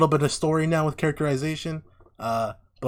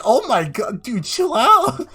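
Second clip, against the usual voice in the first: high, loud mock disbelief, rising higher; exasperated outburst; 10 semitones higher, 7 dB louder, slower than usual. Viewer reacting to a desperate character, loud exasperated outburst. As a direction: exclaim it, stretching the last words in exasperation.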